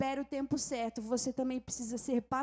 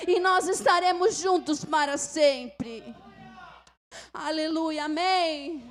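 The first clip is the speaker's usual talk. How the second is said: loud, higher, impassioned sermon voice; fervent preaching; 5 semitones higher, 11 dB louder, slower than usual. Acted. Preacher urging the congregation to hold on, loud and fervent. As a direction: preach it with full fire to the church.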